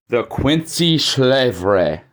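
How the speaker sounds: Schlevre said slowly, the German way; slow, deliberate German Schlevre; German pronunciation. Shown as a demonstration. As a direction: lay out Schlevre carefully in German. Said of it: "That's how it's pronounced".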